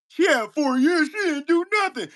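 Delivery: lightly put-on whiny voice mocking a commenter. Mocking online critics, mild whiny parroting of their claim. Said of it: "And you say that shit emphatically".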